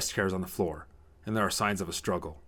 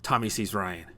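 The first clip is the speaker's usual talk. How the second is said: usual speaking voice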